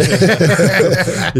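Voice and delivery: high, loud burst of laughter. Real, not acted. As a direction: crack up at your own admission. Laughing at own stage fright.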